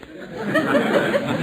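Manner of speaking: breathy high-pitched chuckle; tittering laugh, way up high; high unvoiced laugh. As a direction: giggle high and airy at the thought. Nervous laugh at snowball threat.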